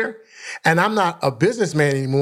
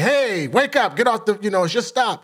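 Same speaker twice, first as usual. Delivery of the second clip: higher-pitched imitated wake-up call; bus driver calling; 6 semitones higher than usual. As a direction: rouse a passed-out passenger, voice pitched up. Bus driver waking a passenger.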